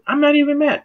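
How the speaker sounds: high, strained pretend-calm denial; mock indignant denial. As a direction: insist you're fine while fuming. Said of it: "You seem kinda mad".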